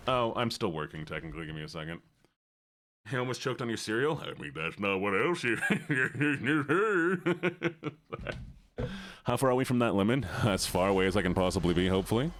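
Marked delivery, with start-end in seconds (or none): 5.6-7.9 laughter. Real, not acted. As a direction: laugh breaking into the sentence. Laughs mid-sentence.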